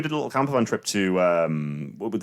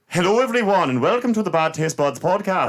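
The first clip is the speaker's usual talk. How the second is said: failed Scottish accent; 7 semitones higher than usual. Shown as a demonstration. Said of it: "That's Irish".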